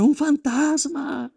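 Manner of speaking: high, drawn-out cry at no great volume; mock scream. Disciples seeing a figure walk on the waves, mock scream. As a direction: imitate a terrified cry, stretched out slowly. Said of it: "empiezan a gritar".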